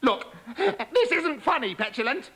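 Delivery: high, slow, alarmed frog voice; panicky frog. Acted. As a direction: frog protesting in the dark.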